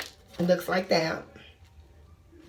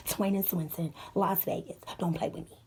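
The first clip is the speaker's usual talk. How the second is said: hushed warning; 2 semitones lower, 6 dB quieter than usual. Real, not acted. Tough aside, dropping voice.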